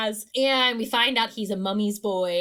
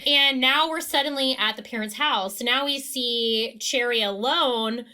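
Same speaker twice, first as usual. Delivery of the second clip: ordinary talk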